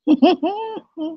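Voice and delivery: laugh. Real, not acted. Laughing on live stream.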